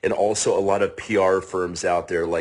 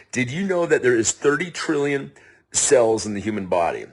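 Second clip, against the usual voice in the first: usual speaking voice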